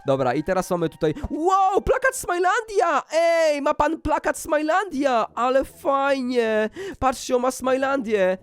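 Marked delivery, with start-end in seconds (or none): 1.3-8.4 high, drawn-out excited exclamations; delighted amazement. Pretend. Player spotting his own poster in a house, delighted amazement. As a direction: gush in slow, high-pitched wonder.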